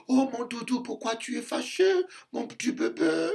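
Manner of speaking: put-on baby-talk a cross husband down; mock soothing wife.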